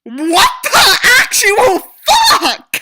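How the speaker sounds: slow, loud laughing disbelief; shocked shout. Real, not acted. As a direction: yell it in stunned disbelief. Outraged reaction to own story.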